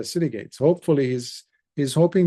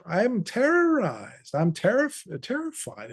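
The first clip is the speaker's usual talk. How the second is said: a terrified king blurting out his sleepless night; shaken, upset king; 10 semitones higher than usual. Acted. Frightened king snaps at priests.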